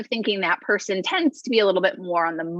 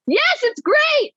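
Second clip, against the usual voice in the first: much higher, louder, slow sing-song yes; mock enthusiasm; 4 dB louder, slower than usual. Integrator mocking her own keen approval of the idea, high and slow. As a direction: ham up a fake eager yes.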